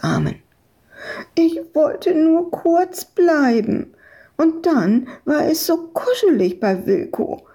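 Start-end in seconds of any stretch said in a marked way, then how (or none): 1.3-7.4 put-on high, plaintive child voice; whining little girl. A narrator's reading. Found girl excuses herself to her rescuer, whining. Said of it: "jammerte Madita".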